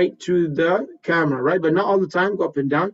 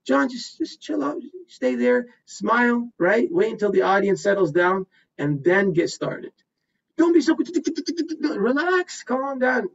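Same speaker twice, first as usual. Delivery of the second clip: calming coach; 6 semitones higher, slower than usual. Pretend. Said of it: "John Lamb comes up".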